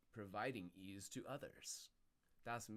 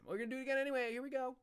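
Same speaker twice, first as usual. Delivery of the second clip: higher and louder, hyped; excited; 13 semitones higher, 9 dB louder, much faster than usual. Real, not acted. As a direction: announce it with hype. Hyped jump.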